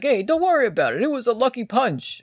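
high reassuring coach; mock reassuring coach. Friend parodying the coach brushing off the lucky punch, high and soothing. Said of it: "coach is like".

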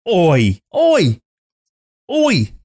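high-pitched mock Cockney call; repeated Cockney exclamation, each one separate; Cockney attempt. Shown as a demonstration. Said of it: "really use my Cockney accent".

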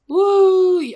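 high, loud "woo"; high-pitched whoop of triumph; celebratory whoop. Real, not acted. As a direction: let out a big drawn-out cheer. Cheers the question record.

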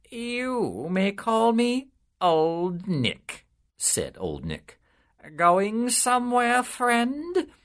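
the devil slyly sizing up a new soul. A narrator's reading. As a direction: draw him in with slick charm. Devil introduces himself slyly.

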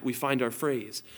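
normal talking voice

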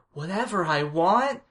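put-on slow, high, loud amazed child; awed kid voice. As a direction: marvel at it like a little kid. Voicing Greg, awed.

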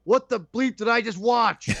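high-pitched mock outraged yell; yelling in disbelief. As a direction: call out the name, then demand an explanation. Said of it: "yelling his name out".

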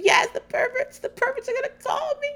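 very high, tearful whimper; hammed-up wail on the verge of crying; mock near tears. Mama Elsa fretting on the phone, mock-tearful. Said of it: "almost in tears".

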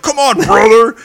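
high, very loud cheer; mock cheer. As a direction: egg him on like a fan at ringside. Cheering Hulk on.

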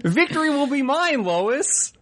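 high cartoon dad voice crowing in triumph; Peter Griffin. Cartoon dad gloating to his wife Lois, high and hammy. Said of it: "That's Peter".

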